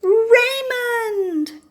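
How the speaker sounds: long, high, loud called-out name; loud joyful shout. Acted. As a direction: beam and shout the name across the room. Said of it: "shouted out loudly".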